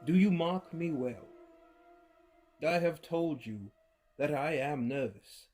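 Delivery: plain reading voice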